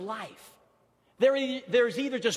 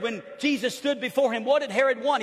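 ordinary delivery

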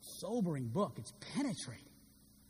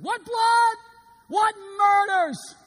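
high, loud terrified cry; tormented shout; 11 semitones higher, 11 dB louder than usual. Acted. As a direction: rave in horror on the deathbed. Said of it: "his last words before he died".

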